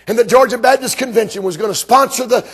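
normal talking voice

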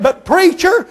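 high pleading cry of preacher. Retold or imitated.

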